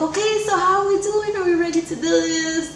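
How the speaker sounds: doctor arriving upbeat to deliver. Retold or imitated. Doctor voiced in birth story.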